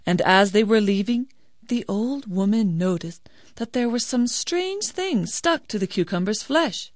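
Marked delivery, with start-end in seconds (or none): none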